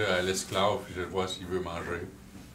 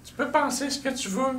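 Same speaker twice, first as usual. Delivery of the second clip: goblin voice. Acted. Goblin keeps talking to prisoner.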